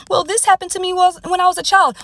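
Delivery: whiny excuse. Retold or imitated. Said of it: "client excuse quoted".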